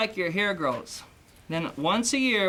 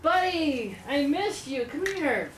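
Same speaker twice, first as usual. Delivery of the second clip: dog greeting; 7 semitones higher than usual. Acted. Greets his found dog.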